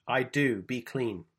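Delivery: ordinary delivery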